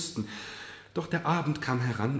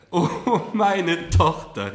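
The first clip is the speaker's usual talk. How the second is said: high, loud, slow outpouring; impassioned cry; 8 semitones higher, 9 dB louder, much slower than usual. A narrator's reading. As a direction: cry out to the daughter, overcome. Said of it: "rief sie".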